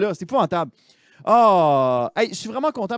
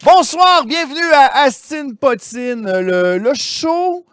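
show host opening; 6 semitones higher, 6 dB louder, much slower than usual. Acted. Host opens show to audience.

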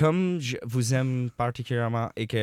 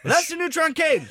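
scolding mom voice; 4 dB louder than usual. Acted. Parent scolding child in sketch.

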